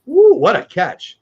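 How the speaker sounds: real cheer at a great catch; excited woo. Real, not acted. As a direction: whoop at the replay of the catch. Watching a football replay.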